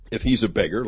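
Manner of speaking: usual speaking voice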